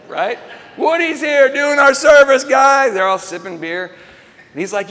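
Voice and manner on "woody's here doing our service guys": loud, high call to buddies; excited announcement. Retold or imitated. Customer boasts to buddies about his serviceman, excited announcement. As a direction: holler the big news to the guys.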